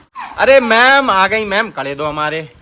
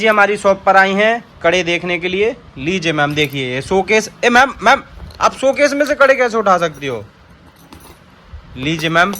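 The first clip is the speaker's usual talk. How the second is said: ordinary talk, nothing marked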